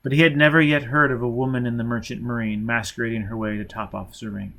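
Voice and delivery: ordinary delivery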